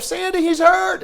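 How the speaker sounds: high, loud, slow call to his wife; the party dad yelling for his wife; panicked call. Retold or imitated. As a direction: call out to Sandy in alarm. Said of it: "he calls his wife".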